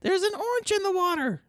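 very high teasing squeal; mock her in a tiny high voice; squeaky mocking voice.

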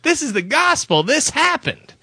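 high emphatic insistence; high indignant protest; insistent. Retold or imitated. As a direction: plead it high like swearing an oath. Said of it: "I'm like".

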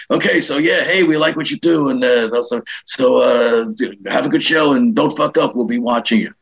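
offhand pep talk in a slightly put-on voice; George Carlin. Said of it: "He goes".

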